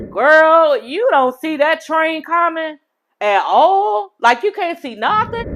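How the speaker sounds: high, drawn-out disbelief; incredulous call-out. Acted. Host scolding Florence for missing the train coming, incredulous. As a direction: stretch it out in high-pitched amazement. Acted exasperated reaction to Florence.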